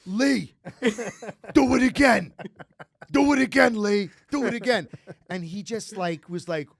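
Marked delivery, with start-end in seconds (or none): 0.0-4.9 much higher, much louder, laughing dad egging him on; excited dad. Retold or imitated. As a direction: cheer the kid on, delighted. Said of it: "And my father went".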